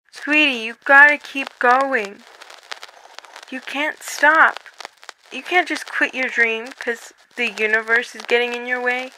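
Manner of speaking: soft motherly urging; gentle encouragement. Acted. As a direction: quietly urge her not to give up. Mom encourages over phone.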